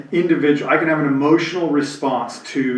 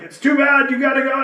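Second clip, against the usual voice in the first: much higher, dismissive parent imitation; stern old-school parent; 10 semitones higher than usual. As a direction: brush off a crying kid, imitated. Parent voiced in story.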